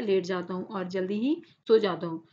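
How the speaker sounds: ordinary delivery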